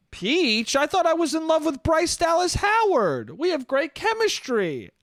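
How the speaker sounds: high-pitched, hammed-up actor parody; Chris Pratt as Mario. Mario confused about his love for Peach, high mocking impression. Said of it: "better than Chris Pratt being like".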